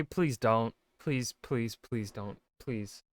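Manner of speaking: ordinary talk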